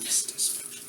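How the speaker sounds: unvoiced whisper in Russian accent; whispered mock Russian aside. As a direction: hush it to yourself like a dark secret. Muttered reprise.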